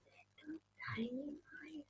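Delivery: tiny hushed whisper. Acted. Whispering to the children about the tiny mice. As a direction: whisper it small and tender.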